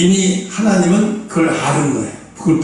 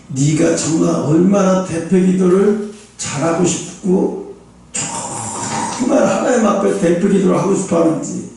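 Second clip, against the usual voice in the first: ordinary talk, nothing marked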